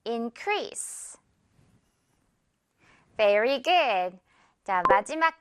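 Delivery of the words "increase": soft increase ending on a clear s; model for repeating. Shown as a demonstration. Teacher modelling increase for learners to repeat. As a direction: say increase softly for students to copy. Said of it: "한 번 더요".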